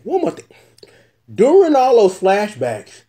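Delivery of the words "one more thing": uncle voice. Pretend. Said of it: "uncle voice".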